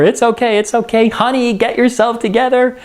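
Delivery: lightly acted wife covering for her husband; smoothing things over. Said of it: "trying to smooth everything over".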